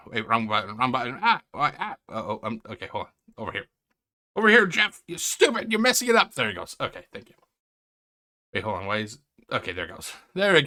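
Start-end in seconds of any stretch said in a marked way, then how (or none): 4.4-6.3 higher, exasperated telling-off; mock scolding. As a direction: scold someone for messing it up. Scolds Jeff over a mishap.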